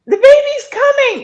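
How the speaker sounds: retold high yell of a woman in labor. Labouring client yelling to her doula on the phone, high loud yell. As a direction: cry it out high and loud, mid-contraction. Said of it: "I can hear the client start yelling out".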